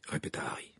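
plain reading voice

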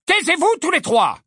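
loud, high scolding yell; shouted order. Acted. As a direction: yell over the children's chanting. Parent silences the chanting children.

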